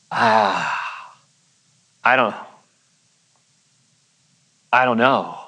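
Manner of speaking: long pauses between halting fragments; acting out someone stumped; frozen, lost for words. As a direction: stall with a drawn-out "ah", then falter. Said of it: "He's just frozen".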